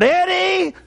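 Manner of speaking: high pitched retold holler of a name. Girl in the store calling up to Eddie, a high holler. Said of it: "she hollered".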